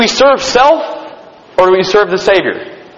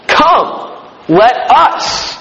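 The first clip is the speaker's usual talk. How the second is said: higher, set-apart divine call; voice of God; 4 semitones higher, much slower than usual. Acted. Preacher voicing God's call in Genesis, lifted and set apart. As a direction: lift it up as God speaking. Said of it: "look what God says".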